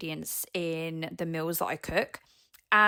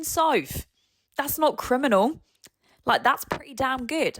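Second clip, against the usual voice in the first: imagined listener voice; 5 semitones higher than usual. Pretend. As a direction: play the listener pushing back, lightly mocking. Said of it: "And you might be thinking".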